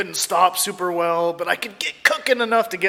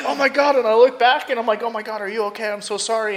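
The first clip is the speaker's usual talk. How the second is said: higher, alarmed voice; retold panicked apology; 5 semitones higher, faster than usual. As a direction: act out a fretful apology.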